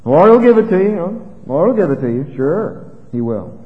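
mock encouragement with exaggerated, swooping pitch; sarcastic prophet. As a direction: humour the king, plainly not meaning it. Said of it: "detected the tone of his voice".

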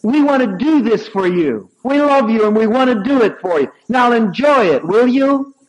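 bossing them out of love; high, loud friendly scolding; loving command. Retold or imitated. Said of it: "She said".

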